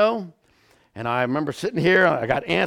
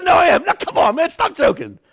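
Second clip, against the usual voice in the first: higher, louder emphatic protest; insisting protest; 13 semitones higher, 7 dB louder than usual. Retold or imitated. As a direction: insist he is not joking. Insisting to disbelieving friend.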